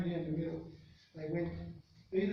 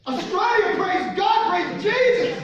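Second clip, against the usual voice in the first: much higher and louder, ringing out Australia praise; Australia shouted high and loud in joy; jubilant preacher shout; 13 semitones higher, 13 dB louder, slower than usual. Retold or imitated. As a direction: whoop it like a thrilled preacher. Reverend exclaims joyfully.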